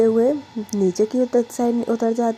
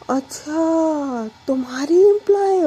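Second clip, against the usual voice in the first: grandma catching on, surprised; 6 semitones higher than usual. Acted. Grandma learns the bride is Pragya's employee, surprised.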